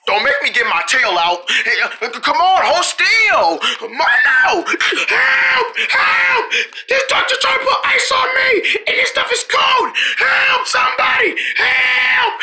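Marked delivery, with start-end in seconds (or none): 2.1-4.7 high, drawn-out exasperated yell; straining shout. Acted. Holding down the squirming turtle, straining shout. Struggle to hold patient still. 5.1-12.3 very high, drawn-out hysterical wail; screaming for help. Acted. Turtle calls for help against icy treatment, screaming for help.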